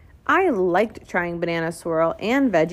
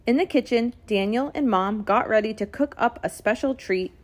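ordinary delivery, nothing marked

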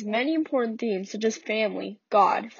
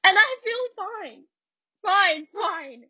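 Walter's bitter outburst. Acted. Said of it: "I'm not good at accents".

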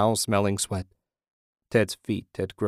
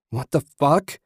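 higher, louder whine of disbelief; pained, whiny outburst; whining; 6 semitones higher, 5 dB louder than usual. A narrator's reading. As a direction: whine it out, rising in pitch. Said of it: "Ted whined".

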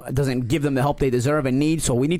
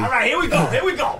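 laughing; 9 semitones higher than usual. Real, not acted. Psyching up for push-ups.

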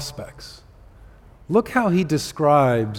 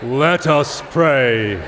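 slow, drawn-out pastoral call to prayer; best pastoral voice; 4 dB louder, much slower than usual. Shown as a demonstration. Pastor calling the congregation to prayer, best pastoral voice. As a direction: intone it slowly and loudly, like a pastor. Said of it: "I'll try my best pastoral".

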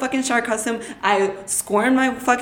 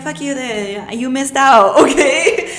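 defiant dismissal; 4 semitones higher, 6 dB louder, slower than usual. Retold or imitated. Defiant quote with laugh.

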